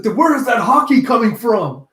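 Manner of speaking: high, loud demanding question; mock indignant retort. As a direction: fire back in exasperation. Ulti's sharp retort.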